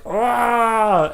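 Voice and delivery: high, drawn-out mock cry; high "ah" without extra volume; mock enraged cry. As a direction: imitate a boy crying out as claws emerge. Imitates James as claws emerge.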